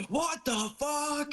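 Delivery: high, drawn-out disbelief; exaggerated appalled read-out; mock shock. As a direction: stretch it out high in mock outrage. Acting out Thomas's shocked message.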